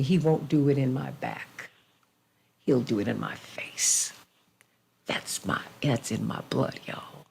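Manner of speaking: low, hushed and intense; hushed intensity. Real, not acted. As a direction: drop to a near whisper. Mother's defiant words, then own pride.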